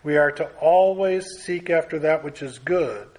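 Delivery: always leaned on and held; the word always pressed firmly; stress on always. Real, not acted. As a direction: hit the word always hard. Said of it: "I want to emphasize the word always".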